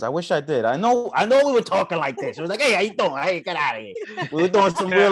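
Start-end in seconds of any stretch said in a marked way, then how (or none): 2.6-3.9 put-on New York accent. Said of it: "classic New York accents".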